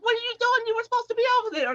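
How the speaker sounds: high, incredulous retelling of a coach; questioning coach. Retold or imitated. Coach to runner who skipped her event, imitated scolding. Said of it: "And he's like".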